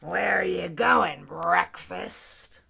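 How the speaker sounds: low, slow, loud growly lion; threatening lion. Acted. Lion grabs mouse, asks him, threatening lion. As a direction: growl it slowly at your prey. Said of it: "the lion asked him".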